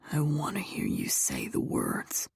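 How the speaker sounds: low, soft male lover's whisper; coaxing whisper. A narrator's reading. Lover wanting to hear the words, whispering low. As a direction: coax her in a low whisper.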